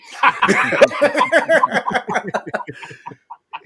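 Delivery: high, loud burst of laughter. Real, not acted. Laughing at his own joke about sesame cake, big burst.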